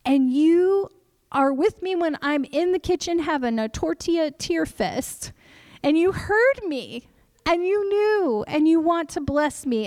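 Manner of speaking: tearful prayer. Retold or imitated. Mother recalls weeping in thanks to God, with a tearful high voice. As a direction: pray through remembered tears. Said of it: "and just weeping".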